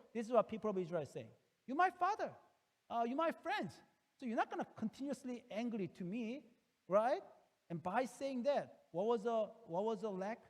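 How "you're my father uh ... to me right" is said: put-on much higher, childlike begging to father; pleading child. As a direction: wheedle like a spoiled child. Israel pleading with God.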